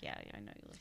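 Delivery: whispered aside. Retold or imitated. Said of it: "So you're like".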